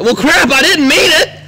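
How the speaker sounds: very loud, high, drawn-out holler; slow, high shout of regret; panicked yell. Acted. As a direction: yell in comic dismay as your ride leaves. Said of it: "and I was like".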